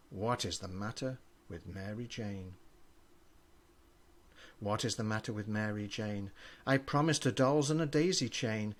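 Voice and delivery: plain reading voice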